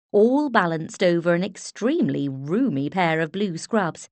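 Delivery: usual speaking voice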